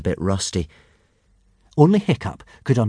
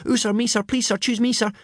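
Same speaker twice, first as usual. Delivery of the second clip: much higher eager schoolboy pleading; eager cries; 13 semitones higher than usual. A narrator's reading. The boys beg Gobber to choose them, much higher and eager. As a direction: beg to be picked. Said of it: "with cries of".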